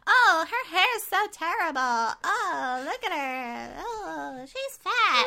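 put-on high airheaded valley girl; mock a shallow mean girl; bimbo doll voice. Mocking the bimbo dolls' voices.

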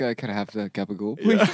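mock gabagool order; laughing mock Sopranos accent; Office-style gabagool. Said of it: "where they're like".

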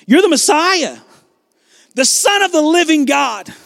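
high, ringing proclamation; with authority. Acted. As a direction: declare it so everybody knows your answer. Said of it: "he says it with authority".

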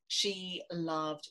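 ordinary delivery

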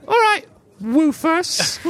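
high, slow urchin voice; knowing kid speaking slowly to a dog; street urchin. Acted. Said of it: "the urchin looks down at the dog and he goes".

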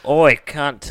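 a rough British-accented demon try; British accent attempt. Shown as a demonstration. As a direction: bark it like a British thug. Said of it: "speak in a British accent".

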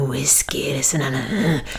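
low, whispery imitation of a sexy rasp; imitating a sultry whisky voice. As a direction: purr it out low and husky. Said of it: "den stemmen du hadde mens du skrek".

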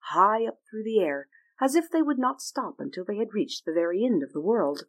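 plain reading voice

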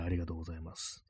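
deep, soft thank-you; low voice. Shown as a demonstration. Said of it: "低い声で言うやつですね".

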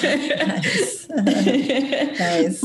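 laughing. Real, not acted. Laughing at host's recommendation.

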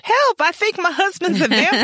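high playful yelp; mock cry for help.

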